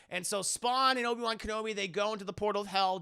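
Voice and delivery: usual speaking voice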